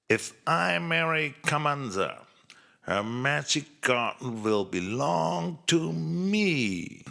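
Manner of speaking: a hint of a scheming villain musing to himself; Hanja's scheming thought. Wicked Hanja plotting to marry Kamanza for her garden, lightly scheming.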